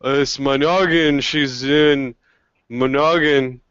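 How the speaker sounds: slow-witted ogre drawing out the doubt; unsure ogre. Acted. Ogre doubts the goblin about the captive, slow and unsure. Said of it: "The ogre kind of looks around".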